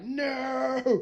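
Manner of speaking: high, distant mock boss voice; a hammed-up promoter's protest. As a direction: play the possessive boss, shouting.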